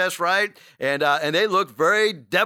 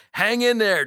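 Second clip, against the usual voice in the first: normal talking voice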